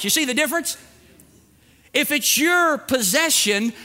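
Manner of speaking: usual speaking voice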